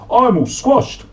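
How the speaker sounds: high, loud whiny dog voice; Slobby Dog voice. Acted. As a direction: squeal it like a squashed dog. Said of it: "said Slobby Dog".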